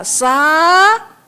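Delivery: SABAR stretched, first syllable rising long; drawn-out word. Shown as a demonstration. Preacher shows patience is long, "sabar" hugely stretched. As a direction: hold the "sa" of "sabar" very long before "bar".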